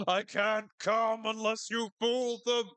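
high, loud, slow ranting shout; Patton Oswalt bit. Retold or imitated. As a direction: yell it like a traumatized veteran comic. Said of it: "the Patton Oswalt bit ... where he's like".

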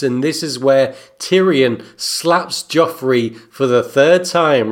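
ordinary talk, nothing marked

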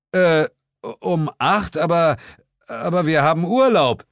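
high, loud pleading; whiny protest. Acted. Narrator protests to Sir James about holiday, whining. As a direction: object weakly.

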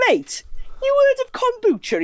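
very high, amazed squeal; incredulous shout. Retold or imitated. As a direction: call over in disbelief. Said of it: "he went".

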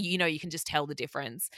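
ordinary delivery

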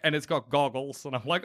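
ordinary delivery

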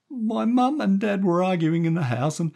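put-on high, run-on child's explanation; anxious little boy. A narrator's reading. Stevie explaining about the cat, anxious little boy. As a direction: pour out the story in a high boy's voice.

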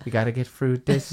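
pop singer impression. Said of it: "He used to go".

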